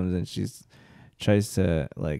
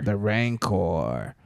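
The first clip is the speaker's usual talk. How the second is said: dramatic slow; 3 semitones lower, 4 dB quieter, much slower than usual. Shown as a demonstration. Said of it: "repeating the monster's name dramatically".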